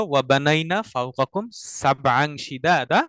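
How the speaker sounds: slow, chanted Arabic verse; Quranic recitation. Shown as a demonstration. Teacher recites the lesson verse to the class. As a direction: recite the verse slowly and melodically. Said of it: "আমাদের যে আয়াতটা ছিল".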